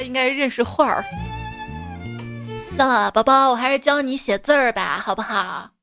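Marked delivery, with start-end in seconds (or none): none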